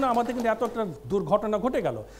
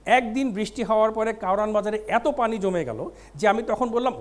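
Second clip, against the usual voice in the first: normal talking voice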